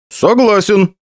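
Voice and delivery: dry smirk on agreeing. A narrator's reading. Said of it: "хмыкнул я".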